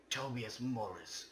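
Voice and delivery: ordinary delivery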